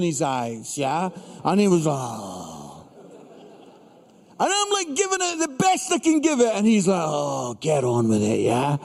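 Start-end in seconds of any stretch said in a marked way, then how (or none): 1.9-2.8 long low fed-up groan; bored teen groan. Retold or imitated. 7.1-8.8 put-on low, dragging teenage grumble; mock a sulky son, low and bored. Son mocking dad's preaching.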